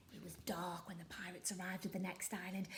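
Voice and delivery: whispered, spooky storytelling; hushed narration. A narrator's reading. Narrator setting a dark scene for children, whispered. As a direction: whisper it to build suspense. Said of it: "whispered narration".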